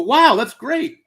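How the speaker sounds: high-pitched, delighted exclamation, mildly hammed; overjoyed at the pardon, voice jumping up; gleeful wow. Imagined pardoned prisoner reacting.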